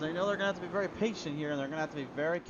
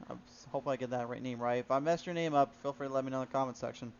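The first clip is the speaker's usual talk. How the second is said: ordinary delivery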